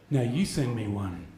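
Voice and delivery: a lightly voiced creepy ask; sleazy low request. Chat partner asking for a photo, low soft sleazy voice. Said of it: "He goes".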